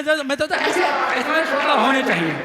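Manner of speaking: high, laughing shout of praise; excited, laughing shout to the crowd. Real, not acted. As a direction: cheer the audience on. Hyping the audience.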